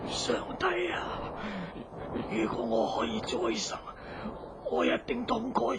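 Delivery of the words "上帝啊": breathless whisper to God; whispered prayer. Acted. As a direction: barely whisper it, dying. Dying man prays.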